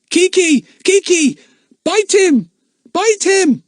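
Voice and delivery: high, loud frantic yell. Acted. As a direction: shout for the parrot to attack. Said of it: "yelled Jack".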